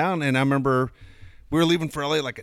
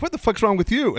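higher-pitched mock indignation; indignant retort; 8 semitones higher than usual. Friend retorts over the bad math, mock indignant. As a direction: throw it back, pitched up and affronted. Retelling own retort, thrown back.

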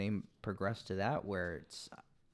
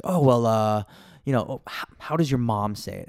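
louder quoted asking; 9 dB louder than usual. Retold or imitated. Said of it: "it comes down to".

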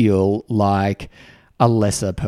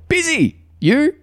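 much higher, clipped cheery answer; chirpy reply. Retold or imitated. Other person answering in the corridor, high chirpy busy reply. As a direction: toss it back high and quick.

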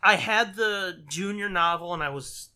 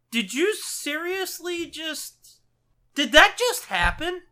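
high, slow, disbelieving; stunned disbelief; 11 semitones higher, 7 dB louder, slower than usual. Retold or imitated. Kid reacting to the spoiler in high disbelief. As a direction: ask in shock. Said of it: "And it's like".

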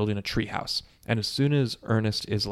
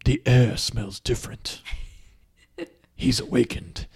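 put-on sense evil like an old seer; eerie old-lady proclamation; ominous old lady. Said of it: "old lady's at her home just being like".

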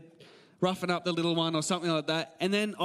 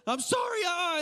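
mock crying kid; 9 semitones higher than usual. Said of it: "they're crying".

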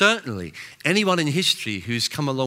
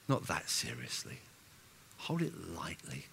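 soft urging; 7 semitones lower, 12 dB quieter than usual. Acted. Gentle pastoral urging.

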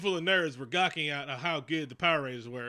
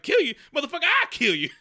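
much higher and louder mock threat; hammed-up angry shout; Blade snaps; 11 semitones higher, 9 dB louder than usual. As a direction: snap back furious as Blade. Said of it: "Blade snaps on him".

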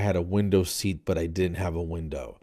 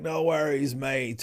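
lightly voiced Australian, higher; a hint of a breezy Australian diver; mild mock Australian accent; 6 semitones higher, slower than usual. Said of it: "or whatever they say in Australian".

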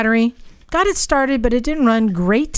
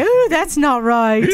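cooing Emma voice, higher; Emma impression; 5 semitones higher than usual. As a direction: coo it like Emma. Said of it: "I thought there was two of you".

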